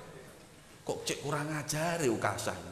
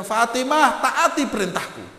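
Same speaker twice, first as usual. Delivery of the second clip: high, loud insistent order; firm command; 13 semitones higher, 11 dB louder than usual. Acted. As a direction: press her to obey. Prophet orders his daughter. (perintah tegas yang lantang)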